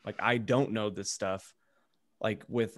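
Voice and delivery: usual speaking voice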